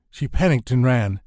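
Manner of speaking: ordinary delivery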